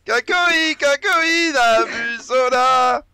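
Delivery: very high, slow silly voice; funny high voice. Pretend. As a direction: squeak it out slowly like a cartoon. Voix bizarre, très aiguë. (voix aiguë et ridicule)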